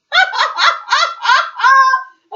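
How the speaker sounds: a loud, high burst of real laughter. Real, not acted. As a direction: let out a big helpless laugh. Big laugh after the saying.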